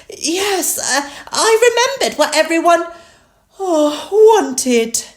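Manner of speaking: high piglet voice broken by yawns; yawning. A narrator's reading. Peppa answers Mummy Pig at evening, yawning. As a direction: yawn through the words like a sleepy child. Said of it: "Peppa yawned".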